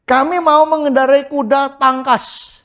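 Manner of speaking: high, slow boastful voice; stubborn people voiced. Preacher voices Israel boasting of swift horses, slow and high. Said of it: "apa lagi".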